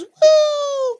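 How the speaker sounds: loud, very high "woo"; imitated arrow whoosh. Game master showing the arrow's last shot, high whooping sound effect. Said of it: "it just goes".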